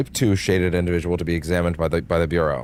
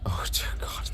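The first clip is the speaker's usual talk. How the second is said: whispered dread; 8 dB quieter, slower than usual. Real, not acted. Dreading a game section.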